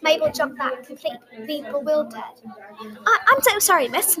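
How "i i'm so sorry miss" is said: high, loud startled apology; exclaiming. A narrator's reading. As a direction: blurt it out, flustered. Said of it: "Mabel exclaimed".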